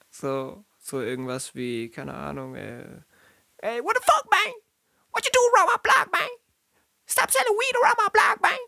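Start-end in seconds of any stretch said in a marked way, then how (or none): 3.6-8.6 fast Bronx gangster voice; gangster accent. Retold or imitated. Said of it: "Und dann sagt Paul, so synchronisiert".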